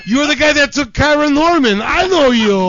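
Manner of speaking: accusing fan. Pretend. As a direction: call him out like a suspicious fan.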